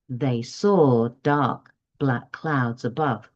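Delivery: slow echo copying the teacher's tune; echo of the model. Shown as a demonstration. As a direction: repeat slowly just as the teacher read it. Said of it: "So then they would repeat back".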